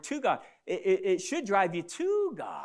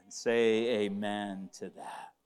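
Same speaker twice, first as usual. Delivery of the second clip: slow call; 10 semitones lower, 3 dB quieter, much slower than usual. Real, not acted. Calling for congregation response.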